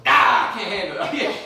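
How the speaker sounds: loud outburst breaking into laughter; mock freak-out. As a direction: pretend to snap under stress, yelling. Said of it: "somebody freaked out and all of a sudden".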